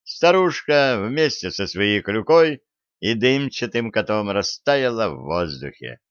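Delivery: plain reading voice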